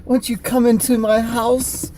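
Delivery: high, slow, hammed-up British wrestler voice; theatrical high-pitched invitation; Stardust. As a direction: draw it out in a strange, lofty British voice. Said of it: "I need an impression of you being Stardust".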